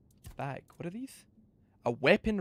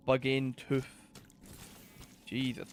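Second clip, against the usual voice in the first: ordinary talk, nothing marked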